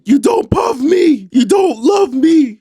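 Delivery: very high, loud, slow wail on "You don't love me"; mock hysterical shout. Acting Heather screaming at Louis, high and loud. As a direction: shriek the accusation. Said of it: "Heather comes back saying".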